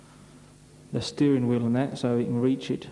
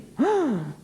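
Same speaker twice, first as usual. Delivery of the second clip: shocked gasp; 11 semitones higher than usual. Acted.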